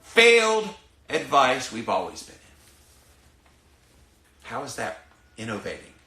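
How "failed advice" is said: much higher and louder, stretched out in disgust; slow, loud, high frustrated cry; exasperated outburst. Real, not acted. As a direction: spit out stupid, failed with real exasperation. Exasperated rant at failed advice.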